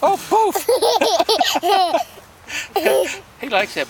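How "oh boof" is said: giggling through the word; laughing. Real, not acted. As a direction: laugh as you puff it out. Blowing dandelion seeds, laughing.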